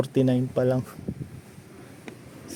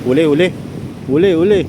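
excited urging; 3 semitones higher, faster than usual. Real, not acted. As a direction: egg on the catch eagerly. Urging on a fish bite.

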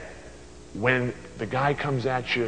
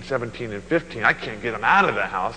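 ordinary talk

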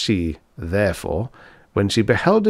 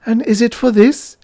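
very high, loud wail; tearful cry; 6 dB louder than usual. A narrator's reading. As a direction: cry it out as a wounded question. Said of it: "Elisa cried, burying her face in a handkerchief".

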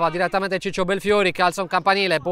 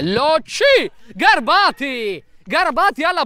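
excited; 6 semitones higher, 4 dB louder, slower than usual. Real, not acted. Attack builds toward goal.

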